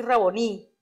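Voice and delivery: "Raboní" stressed on the last syllable, "ní"; stress on final I. Shown as a demonstration. Reader pointing out the written word, "Raboní" stressed on the final í. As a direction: put the accent on the "í" ending "Raboní". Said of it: "tiene el acento en la I".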